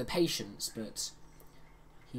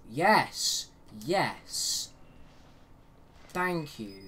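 excited cheer; 3 semitones higher, 5 dB louder, much slower than usual. Real, not acted. Player cheering at finding a battery, excited. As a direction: cheer at finding what you hoped for.

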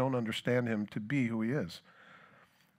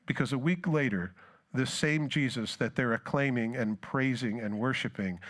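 usual speaking voice

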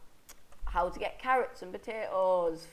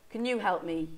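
ordinary delivery

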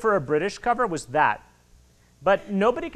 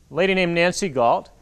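usual speaking voice

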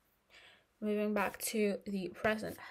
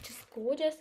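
ordinary delivery

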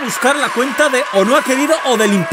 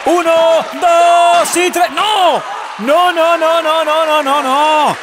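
wrestling commentator; 6 semitones higher than usual. Real, not acted. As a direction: call the action with rising excitement. Play-by-play builds to near fall. (narrador de lucha libre emocionado)

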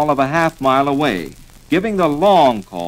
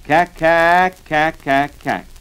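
sharp staccato duck call blasts; duck call honks; 2 semitones higher, 4 dB louder, much slower than usual. Shown as a demonstration. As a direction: honk out cack like a duck call. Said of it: "blow these words".

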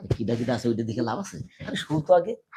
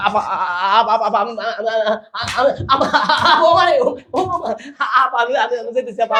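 loud, much higher, stammering in terror; panicked cry; 12 semitones higher, 13 dB louder than usual. Acted. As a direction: yelp out the words like he's been caught. Caught lover panicking, pleading. (আতঙ্কে চিৎকার করে তোতলানো)

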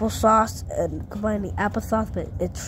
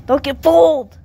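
loud, higher warning shout; 6 semitones higher, 11 dB louder than usual. Acted. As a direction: warn them with a shout. Warning viewers.

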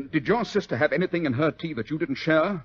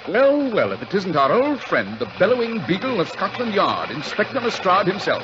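louder, amused mock welcome; teasing; 6 semitones higher, 5 dB louder than usual. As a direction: needle an old rival. Mocking greeting to inspector.

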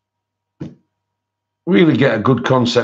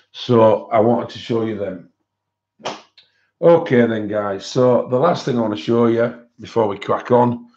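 normal talking voice

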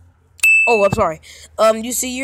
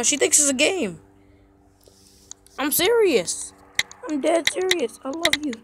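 usual speaking voice

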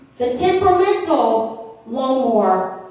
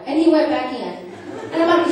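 normal talking voice

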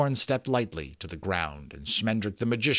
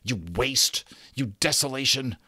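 raised, higher-pitched storybook tirade; indignant cursing; 5 semitones higher, 4 dB louder than usual. A narrator's reading. Magician pinning Rook down and cursing him, acted indignant tirade. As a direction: hurl each curse with rising indignation.

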